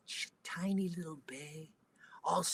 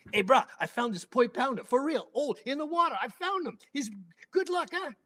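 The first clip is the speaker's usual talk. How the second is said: high, loud, eager boasting to the driver; high-pitched proud bragging; proud excitement; 9 semitones higher, 5 dB louder than usual. Retold or imitated. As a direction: brag like you won the lottery. Proudly telling bus driver.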